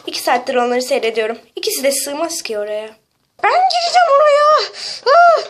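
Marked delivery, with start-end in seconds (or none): 3.4-5.4 very high, loud insistence; shrieked protest ending in a cry; panicked squabbling. Acted. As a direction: squeal your claim to the spot. Fighting over hiding place.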